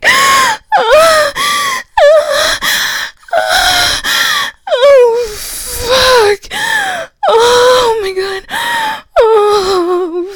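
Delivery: very high, loud, crying out; climax screams. Acted. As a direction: scream it out at the peak. Acted climax.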